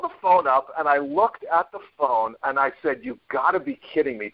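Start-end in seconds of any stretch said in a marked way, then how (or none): 3.0-4.3 high-pitched, disbelieving aside to oneself; retold disbelief, pitched right up; incredulous aside. Man holding the phone away, incredulous to himself. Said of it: "to myself".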